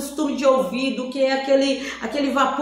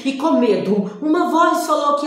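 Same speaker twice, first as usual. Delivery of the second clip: ordinary talk, nothing marked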